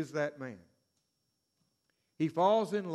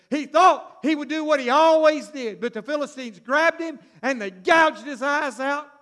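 louder preacher cadence, each refrain hammered; preaching at full force; 5 semitones higher, 8 dB louder than usual. Acted. Preacher hammering the refrain to the crowd, louder. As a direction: drive the refrain home to the congregation. Preacher climax on Samson's fall.

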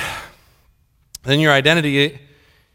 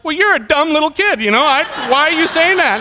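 high-pitched, hurt dad lashing back; wounded, mad; 9 semitones higher than usual. Retold or imitated. Dad attacks little daughter for hurtful words, wounded and mad. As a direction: act out getting all affected, voice pitched way up. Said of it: "I'm getting all affected and wounded".

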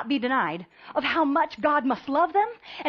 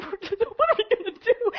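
mock despair; 8 semitones higher, slower than usual. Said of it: "And it's just total despair".

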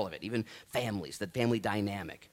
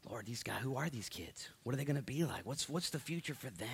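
soft prayer; 7 dB quieter than usual. Real, not acted. Praying over his kids.

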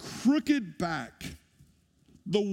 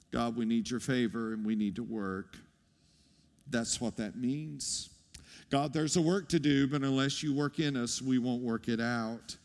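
ordinary talk

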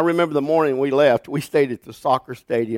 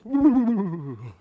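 dramatic ooh; 5 semitones higher, 4 dB quieter than usual. Retold or imitated. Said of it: "I'm very dramatic".

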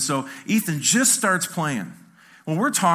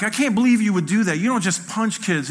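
raised, louder voice of reproach; scolding dad; 7 semitones higher, 4 dB louder than usual. Retold or imitated. Dad wheeling his son out of the play area, scolding. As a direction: tell off a child, pitch up.

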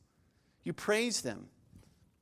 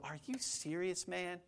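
hushed, much softer mock incredulity; quiet disbelief; 11 dB quieter than usual. Preacher acts a listener balking at grace, hushed disbelief. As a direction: ask it under your breath, stunned.

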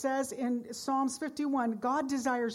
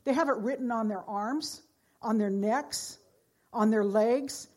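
normal talking voice

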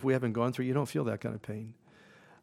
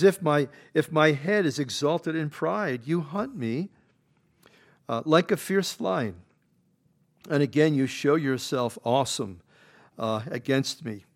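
scripture read at full force; 3 semitones higher, 5 dB louder than usual. Acted. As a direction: proclaim each line like a pulpit reading. Reads Job's words.

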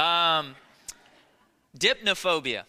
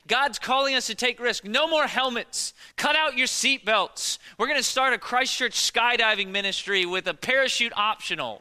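higher, hammed-up rallying cry; mock zealous announcement; 6 semitones higher, 4 dB louder than usual. Preacher parodies reckless believers taking all risks, mock zeal. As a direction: proclaim it like an overeager crusader.

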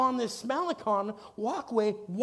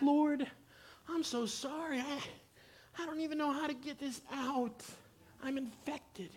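tearful prayer; 3 semitones higher, 8 dB quieter than usual. Retold or imitated. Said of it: "And I'm crying".

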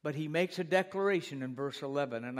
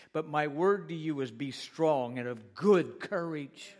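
ordinary talk, nothing marked

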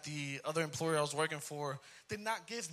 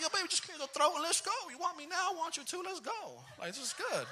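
much higher, excited pleading; eager; 11 semitones higher, 4 dB louder than usual. Retold or imitated. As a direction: coax your wife, giddy. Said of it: "So I was like".

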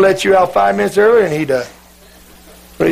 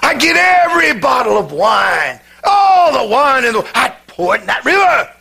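excited preacher; 5 semitones higher than usual. Retold or imitated. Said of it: "he got real excited".

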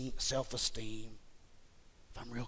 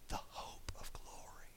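breathy whisper; 10 dB quieter than usual. Real, not acted. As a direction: drop to a whisper. Drops to whisper.

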